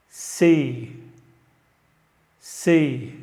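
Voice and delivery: slow, clear letters with pauses for repeating; letter drill. Shown as a demonstration. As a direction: read each letter out for students to repeat. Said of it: "voy a leer todas las letras y ustedes repiten".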